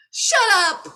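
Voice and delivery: high, loud embarrassed "Shut up!"; mock shout. Recalling how she denied crying to friends, mock yelling. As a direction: snap it out high and loud, half laughing. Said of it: "I'd be like".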